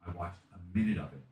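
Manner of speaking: normal talking voice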